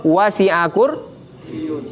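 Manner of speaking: very slow recitation, the ya in kursiyun doubled; teacher reciting the verse to show the shadda. Shown as a demonstration. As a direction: lean on the yy of kursiyun. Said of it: "pakai tasydid kan".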